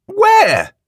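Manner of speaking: high, loud panicked demand; frantic to know. A narrator's reading. Shopkeeper panicked, demanding where he is. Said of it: "sounding panicked".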